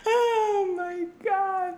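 high squealing laugh, drawn out slowly; laughing cry. Real, not acted. Overwhelmed by the joke.

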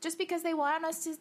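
ordinary talk, nothing marked

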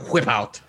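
"whip" opened with a puff of air; big blown wh on "whip"; exaggerated wh. Shown as a demonstration. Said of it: "I can't pronounce it like you guys".

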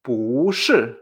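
不 rising, then 是 falling sharply; second-fourth tone pattern. Shown as a demonstration. Teacher demonstrates the tone change, 不 up and 是 down. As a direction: lift 不 and drop 是 hard. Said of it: "un segundo cuarto".

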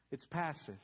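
ordinary talk, nothing marked

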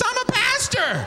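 high-pitched comic squeal; flustered high outburst; scandalized protest. Retold or imitated. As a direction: blurt it out, appalled. Scandalized thought, naked woman in office.

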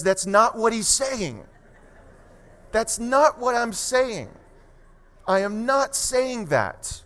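slow, forceful disclaimer to a congregation; emphatic denial. Real, not acted. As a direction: hammer each word of the denial slowly. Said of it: "I just wanna say emphatically".

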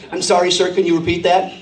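low, puzzled American operator; American telephone-service voice. Acted. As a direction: query politely in an American accent. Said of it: "change your accent and you were there".

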